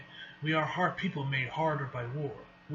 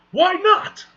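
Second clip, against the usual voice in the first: high, loud comic protest; mock indignant shout; 13 dB louder than usual. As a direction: squawk the question in mock outrage. Acted indignant reply to the refusal.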